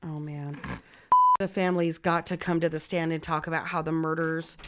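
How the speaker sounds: ordinary talk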